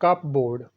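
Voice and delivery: कपबोर्ड with the p and full board, cup-board; wrong pronunciation. Shown as a demonstration. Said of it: "ये भी अक्सर गलत पढ़ा जाता है".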